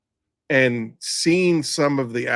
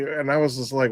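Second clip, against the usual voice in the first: ordinary delivery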